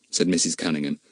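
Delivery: ordinary delivery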